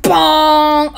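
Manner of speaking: loud ringing "bong"; impact sound effect. Acted. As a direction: shout a cartoon hit noise. Imitates impact noise.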